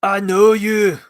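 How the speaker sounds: high, loud mock Scottish lady; Scottish alien lady greeting Jude Law, hammed-up accent; bad Scottish accent. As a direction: put on a hammy Scottish brogue. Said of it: "I can't do a Scottish accent".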